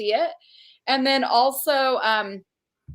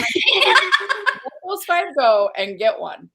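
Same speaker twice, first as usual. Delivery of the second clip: laughing it out slowly; 4 semitones higher, 6 dB louder, much slower than usual. Real, not acted. Host reacting to a viewer's comment, laughing. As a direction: say it through real laughter.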